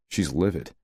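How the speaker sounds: usual speaking voice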